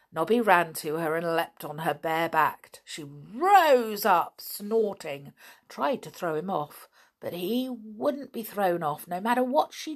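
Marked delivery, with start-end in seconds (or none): none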